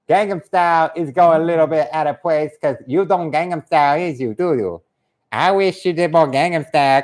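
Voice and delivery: put-on caricatured Korean accent, chatty; put-on Korean-accented banter. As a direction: chat in a mock Korean accent. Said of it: "do the Korean voice like this".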